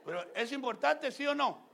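loud, high, insistent preacher's questioning; preaching at full force. Acted. Pastor drilling his church, preaching at full force. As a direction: hammer each question at the congregation.

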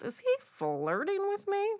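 high, rising mock wonder; playful imitation of a flattered crush; voicing Candy's hopeful question. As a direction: swoop up high as if just realizing.

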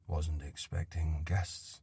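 put-on low, soft voice of a gentle old man; a weary old man coaxing a stranger softly; quiet old man. Old man character speaks.

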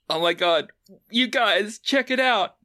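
high, building gleeful squeal; hammed-up glee bursting to deliver the punchline; giddy excitement. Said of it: "he's so excited to say it".

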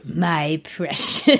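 low, slow, hissing creature croak; Gollum voice. Retold or imitated. Podcast host imitating Gollum for co-host, low and slow. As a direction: rasp it out like the creature gloating. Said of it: "you do a better version of the voice".

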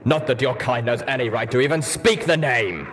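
snapping. A narrator's reading. Steel Ranger rebukes the zebra, snapping. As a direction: rebuke with offended force. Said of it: "snapped the Steel Ranger".

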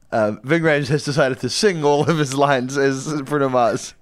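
ordinary talk

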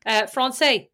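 French spoken with a broad Irish accent; halting Irish-accented French, laughing. Shown as a demonstration. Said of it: "if you're Irish".

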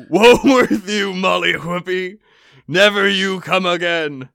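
slow booming curse; loud giant curse. Acted. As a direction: roar after her.

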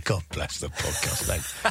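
hushed, slow, reverent thanks to the heavens; a soft mock blessing, looking skyward; whispered gratitude to the sky, slowed down; mock prayer. Looks up to the skies.

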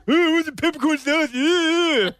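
very high, garbled squawk; mocking drunk co-host. Host caricatures co-host with her gin, high mocking squawk. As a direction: squawk a hammed-up tipsy taunt. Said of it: "sitting there with a gin in your hand going".

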